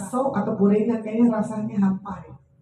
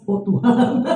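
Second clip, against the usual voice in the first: ordinary talk, nothing marked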